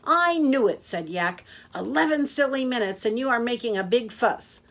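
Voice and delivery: high, loud, fast-talking taxi driver; a cheerful blabbermouth who never stops; loud chatty Yak. Acted. Yak the taxi driver chattering to his passenger, loud and high.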